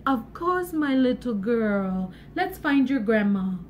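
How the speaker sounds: gentle low storybook helper; kindly woodcutter. Acted. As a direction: comfort the child, slowing and dropping low. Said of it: "the woodcutter said".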